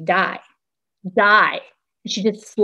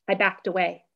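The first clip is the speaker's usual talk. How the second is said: plain reading voice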